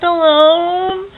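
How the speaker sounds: fighting tears while talking about grief; close to tears. Real, not acted. Tearful over grandfather's death.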